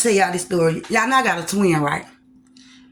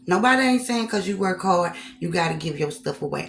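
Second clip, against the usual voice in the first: usual speaking voice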